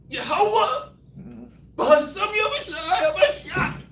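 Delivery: high pleading mock prayer, building; desperate prayer. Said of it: "I'm like".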